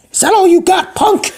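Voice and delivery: very high falsetto granny taunt; hammed-up tough granny; feisty old lady. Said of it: "and she's like".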